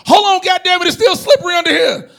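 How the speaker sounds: high, loud panicked shout; high loud alarm at the masseuse; panicked yell. Retold or imitated.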